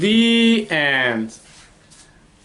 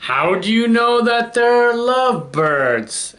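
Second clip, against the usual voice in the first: elephant asking; 7 semitones higher than usual. A narrator's reading. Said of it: "asked the elephant".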